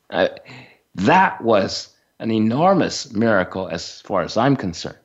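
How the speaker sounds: normal talking voice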